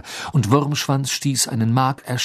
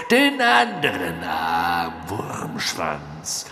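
cold dark lord; 3 semitones lower, much slower than usual. A narrator's reading. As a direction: order him coldly. Voldemort orders servant.

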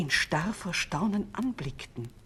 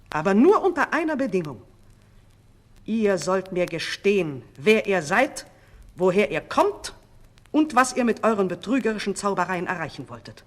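loud, insistent conditions; stern command; 2 semitones higher, 7 dB louder than usual. A narrator's reading. King demanding the sorcerers' confession, stern. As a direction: lay down the terms forcefully. King sets terms for mercy.

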